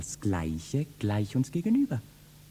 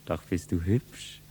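ordinary delivery

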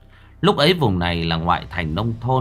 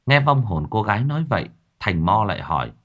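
ordinary delivery, nothing marked

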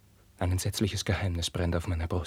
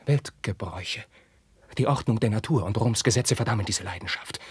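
usual speaking voice

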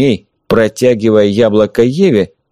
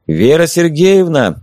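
higher, slow call from a distance; 7 semitones higher, slower than usual. A narrator's reading. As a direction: shout her name from outside. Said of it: "Снова позвали снаружи".

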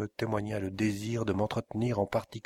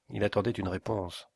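usual speaking voice

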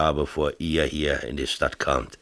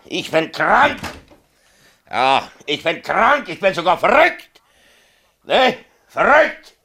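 higher, louder mocking self-accusation; husband sarcastically echoing his wife's accusation, higher and louder; bitter sarcasm; 11 semitones higher, 5 dB louder than usual. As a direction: throw her words back, sneering. Mayor bitterly mocks wife's words.

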